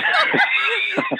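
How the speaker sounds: high shrieking laugh; loud burst of laughter. Real, not acted.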